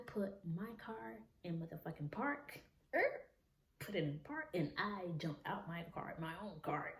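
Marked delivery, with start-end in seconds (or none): none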